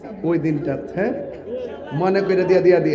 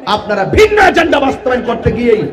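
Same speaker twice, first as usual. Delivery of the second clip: higher, louder sermon at full force; fiery preaching; 9 semitones higher, 8 dB louder than usual. Acted. Preacher denouncing politicians to the rally, shouting. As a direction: rouse the crowd, building to shouts.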